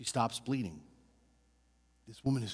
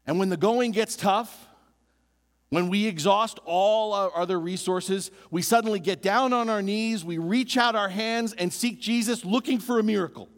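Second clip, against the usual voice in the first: louder and higher, driving preacher cadence; full-force preaching; 5 semitones higher, 4 dB louder than usual. Acted. Preacher rousing the congregation, loud and high. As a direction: preach it building to a climax.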